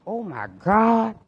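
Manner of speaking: realizing the mistake; slow dismay. Retold or imitated. Realizes he's in wrong lane.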